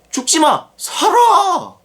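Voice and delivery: tempting voice. Pretend.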